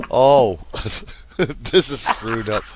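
laughing. Real, not acted. Laughing at forgetting the goal.

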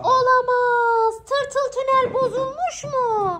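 high, slow, hammed-up shock; mock dismay. Mother to children over the broken toy tunnel, playful mock alarm. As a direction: act out alarm for the kids.

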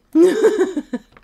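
loud, high burst of laughter; laughing. Real, not acted. Laughs at the game.

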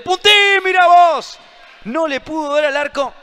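high loud near-miss shout; excited shout. Real, not acted. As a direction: burst out at the close chance.

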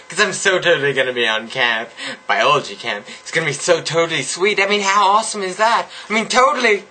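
put-on high bubbly valley girl voice, every totally gushed; mock valley girl accent. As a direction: play a ditzy valley girl gushing to her friends. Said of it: "I really can't do this accent".

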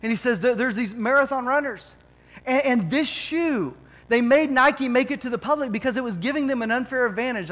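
usual speaking voice

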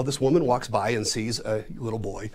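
ordinary delivery

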